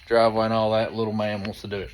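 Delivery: normal talking voice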